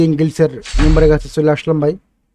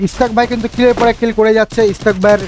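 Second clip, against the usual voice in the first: excited esports caster; 4 semitones higher than usual. Acted. Live play-by-play of kills.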